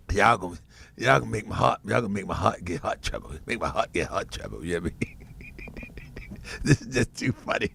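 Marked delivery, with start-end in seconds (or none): 3.7-7.7 breathless whisper through laughter; laughing whisper. Real, not acted. Host wheezing with laughter at critics. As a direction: barely get it out for laughing.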